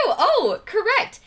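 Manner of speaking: high, laughing exclamation; delighted to be right; pleased surprise. Real, not acted. Streamer surprised that her quiz guess was right.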